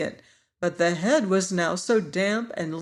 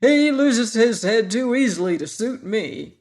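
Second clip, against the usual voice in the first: louder, vicious declaration; viciously; 3 semitones higher, 5 dB louder than usual. A narrator's reading. Sawhorse refuses the pumpkin head, vicious and loud. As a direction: spit out the grudge. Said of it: "declared the sawhorse viciously".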